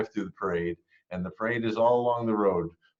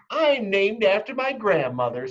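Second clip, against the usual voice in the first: high, louder, showing off; smug classmate; 11 semitones higher, 5 dB louder than usual. Acted. Victoria boasting to Chrysanthemum, high and smug. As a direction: brag like a snooty little girl. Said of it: "said Victoria".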